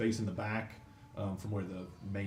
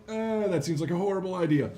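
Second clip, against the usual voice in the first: high, doubtful goblin voice; goblins, much higher, uneasy about the boss's plan; nervous goblins; 10 semitones higher, 8 dB louder than usual. Acted. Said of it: "the two goblins are like".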